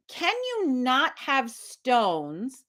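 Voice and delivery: high, drawn-out question, word by word; painstakingly slow and high-pitched; slow, spelled-out asking. Retold or imitated. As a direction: ask as if explaining to someone very slow. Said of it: "This is how you had to, I had to ask him".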